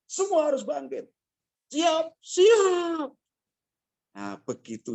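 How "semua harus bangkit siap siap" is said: much higher, loud defiant shout; rallying shout. Retold or imitated. The family answering their leader, a loud rallying shout. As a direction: shout it like a battle cry. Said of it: "jawabannya langsung spontan".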